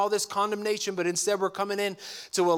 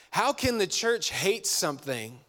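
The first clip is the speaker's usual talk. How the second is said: ordinary talk, nothing marked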